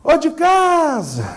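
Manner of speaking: calling out. Acted. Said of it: "ela grita".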